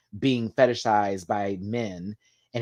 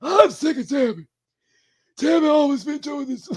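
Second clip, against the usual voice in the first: imitating the brother Chris, pitched way up; Chris impression; 7 dB louder than usual. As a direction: slip into Chris's high sing-song voice. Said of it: "He talks like this".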